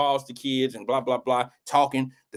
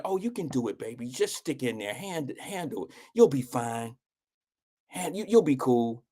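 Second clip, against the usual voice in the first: put-on much softer, gentle coaxing to a child; soft mothering encouragement; 6 dB quieter than usual. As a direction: hush it like a mother soothing her boy. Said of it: "a different kind of".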